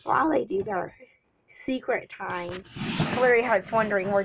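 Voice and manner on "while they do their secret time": ordinary delivery, nothing marked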